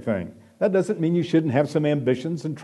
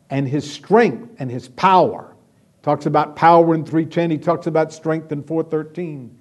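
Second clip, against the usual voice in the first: usual speaking voice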